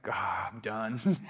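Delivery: slow, low, soft giving up; retold surrender, sunk low and drawn out; weary surrender. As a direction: let it out slowly, as if finally beaten. Retelling his surrender in bed.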